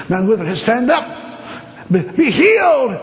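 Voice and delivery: loud, high preacher's command; healing command. Retold or imitated. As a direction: call it out high, stretching the final words. Retold healing command at meeting.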